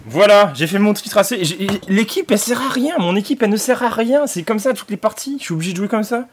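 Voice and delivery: high, loud angry rant. Real, not acted. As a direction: vent about the useless team. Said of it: "désolé pour la rage".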